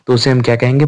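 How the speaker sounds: normal talking voice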